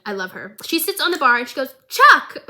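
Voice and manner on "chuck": high, loud call of a name. Retold or imitated. Jinx calling out to the bartender, high loud shout. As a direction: yell "Chuck!" across the bar, much higher.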